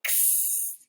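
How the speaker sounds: x as a breathy unvoiced ks hiss; x sound. Shown as a demonstration. Teacher modelling the letter sound for kids, a whispered ks. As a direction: make x as a soft k then s, no voice. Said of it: "It just happens super fast".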